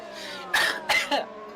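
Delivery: harsh coughing fit; coughing, something down the wrong way; choking cough. Real, not acted. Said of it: "Sorry, I'm choking here".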